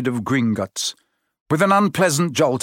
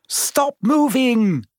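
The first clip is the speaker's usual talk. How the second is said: high, drawn-out command; urgent order; 11 semitones higher, much slower than usual. A narrator's reading. Hermione to boys struggling in the plant, ordering. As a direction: order them sharply, pitched high. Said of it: "Hermione ordered them".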